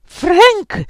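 high, loud rebuke; scandalized outcry; shocked exclamation. A narrator's reading. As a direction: exclaim the name in protest. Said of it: "esclamò Beatrice".

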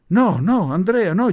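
loud, high cries of refusal; urgent shouting. A narrator's reading. Relatives calling to the groom, urgent shouts. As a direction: cry out to stop someone. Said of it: "gli gridarono subito parecchie voci".

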